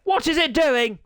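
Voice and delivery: high, loud complaint; exasperated outburst. Real, not acted. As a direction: cry out at the stalling software. Exasperated at slow software.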